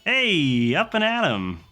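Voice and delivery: high, upbeat greeting, rising into a high squeak; cheery mechanic Chip. Acted. Mechanic Chip greets the pilots cheerily, high and bright. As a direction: chirp a wake-up call at the pilots. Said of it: "and he says".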